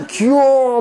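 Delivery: high, loud squeal of adoration; mock-adoring squeal; cutesy gushing. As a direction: gush over a tiny kitten, drawn out and high. Acts out gushing over a kitten.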